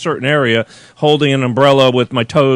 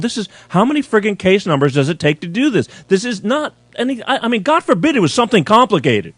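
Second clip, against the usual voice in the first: exasperated rant; 6 semitones higher than usual. Retold or imitated. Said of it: "I'm going".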